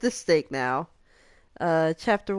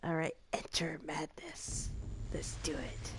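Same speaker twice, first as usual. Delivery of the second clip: spooky whisper; 3 semitones lower, 16 dB quieter than usual. Acted. Ominous start of horror game.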